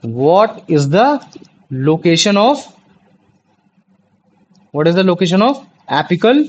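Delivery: each word drawn out slowly for notes; slow dictation. Real, not acted. Tutor dictating a question to students, very slow. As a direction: dictate it so they can write along.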